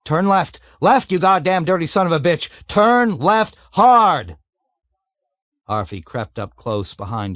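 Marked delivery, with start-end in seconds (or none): 0.1-4.4 frantic screamed orders. A narrator's reading. Panicked bombardier screams directions at pilot. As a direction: bark the turn with panicked rage.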